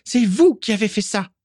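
high-pitched furious accusation; beside herself with rage. A narrator's reading. Carla bursting in to accuse Fergus, enraged. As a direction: spit it out high and outraged. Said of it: "éructa Carla hors d'elle".

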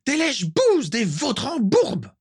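high, slow, spat-out insults; venomous insults. Acted. Walburga insulting the painter, slow high venom. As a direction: spit each insult with disgust. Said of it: "rugit Walburga".

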